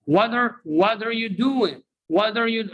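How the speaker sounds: "What are" as "wadder", pitched high for repeating; linked model phrase. Shown as a demonstration. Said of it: "repeat after me".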